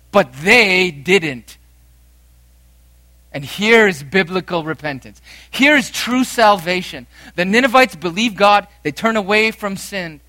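a preacher driving home how the Ninevites repented; full-force preaching. Acted. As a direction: thunder out true repentance. Preacher building to a climax.

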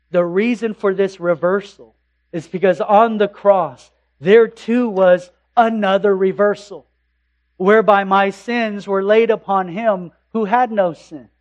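loud, fervent pulpit proclamation; full-force preaching. Acted. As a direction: hammer each word of the cross home.

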